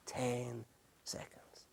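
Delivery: breathy whisper, very slow. Real, not acted. Preacher whispering the key point to the congregation. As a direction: hush the words to drive them home. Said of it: "whispered emphasis of key phrase".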